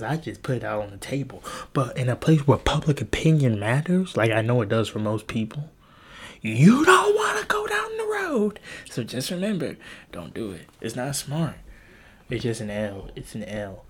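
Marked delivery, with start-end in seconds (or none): 6.4-8.6 high, whispered-shout warning voice; sudden high theatrical warning; mock menacing warning. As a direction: spook them off the road in a strained high voice. Warning listeners, sudden put-on voice.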